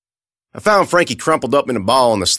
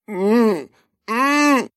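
high whimpering hum; 13 semitones higher than usual. Acted. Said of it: "she said".

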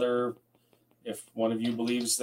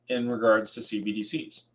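ordinary talk, nothing marked

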